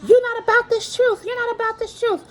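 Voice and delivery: high, exaggerated scolding; mock outraged accusation. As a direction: imitate a husband pouncing on a slight, high-pitched. Acted quote of husband's accusation.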